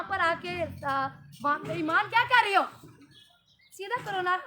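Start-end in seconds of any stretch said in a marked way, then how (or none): 1.8-4.4 irritated scolding. Real, not acted. Scolding child during filming.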